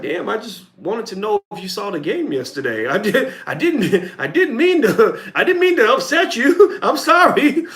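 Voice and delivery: high, loud hammed-up hurt apology with laughter; an overdone high, loud laughing sorry; stunned apology. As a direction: play the startled asker backing off. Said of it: "And you're like".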